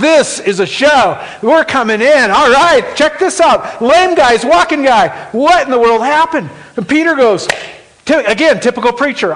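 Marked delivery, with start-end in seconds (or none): 0.0-6.5 loud, high, amazed bystanders; louder, higher crowd chatter; excited crowd. Acted. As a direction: call out in wonder at the miracle.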